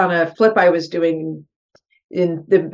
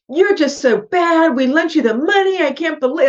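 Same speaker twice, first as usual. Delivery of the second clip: retold angry yelling; 8 semitones higher than usual. Lender scolding the investor over the loan, high angry mock yell. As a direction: play the furious lender laying into her. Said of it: "yelling, like".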